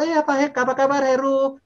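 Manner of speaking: very high, loud, delighted-to-hear-you reply; friend answering phone. Acted. She recognises the caller's name, high happy phone answer. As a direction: answer the call in a high, sing-out voice. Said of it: "Ibu Mul pasti dengar nama menjawab".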